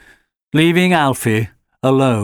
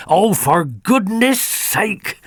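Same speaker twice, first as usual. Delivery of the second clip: slow, exasperated exclamation; mild exasperation; 8 semitones higher, slower than usual. Acted. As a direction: sigh it out, fed up with the puppy. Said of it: "exclaimed Fix-It".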